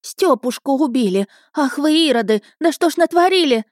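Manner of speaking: high-pitched, distraught wailing; scandalised mother's lament; lamenting woman. A narrator's reading. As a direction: cry out in horror at the boys. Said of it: "снова запричитала женщина в фартуке".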